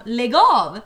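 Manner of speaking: loud curt "Lägg av"; sharp brush-off. Retold or imitated. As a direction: shut him down hard. Tjejen säger åt killar. (skarpt och högt avvisande)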